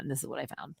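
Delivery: hushed teaser before the clip; real soft intro; whispered reveal. Real, not acted. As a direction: whisper like sharing a secret. Teasing the upcoming clip.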